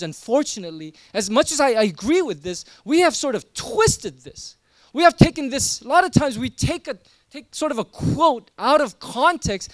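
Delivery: loud, urgent preacher's push; impassioned preaching. Acted. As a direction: drive it home to the congregation. Preaching at full force.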